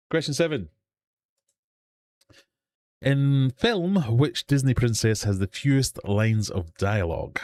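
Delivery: high, projected quizmaster announcement; game-show question read, starting high and stepping down; quiz host. Acted. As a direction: announce the question and options to the room.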